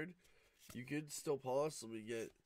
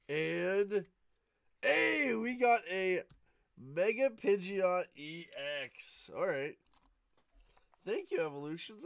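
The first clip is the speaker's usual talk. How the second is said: much louder, higher thrilled yell; excited shout; 6 semitones higher, 9 dB louder, slower than usual. Real, not acted. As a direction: shout with joy at the pull. Pulls an ultra rare card.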